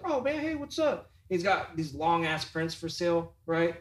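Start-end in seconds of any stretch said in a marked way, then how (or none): 0.0-1.1 much higher, eager buddy voice; imitating the artist. Teller imitating the tattoo artist greeting him, high and eager. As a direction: pitch it up like an overfriendly tattoo artist. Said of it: "And he was like".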